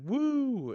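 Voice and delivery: high, loud "woo"; one big acted cheer; cheering crowd whoop. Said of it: "all of them are like".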